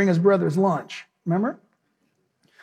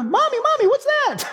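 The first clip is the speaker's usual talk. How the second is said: put-on much higher little child's voice; baby zebra. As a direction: squeak it up like a tiny scared foal. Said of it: "Can you imagine a little baby zebra?".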